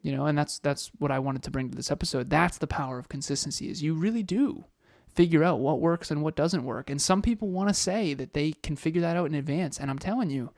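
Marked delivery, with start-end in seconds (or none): none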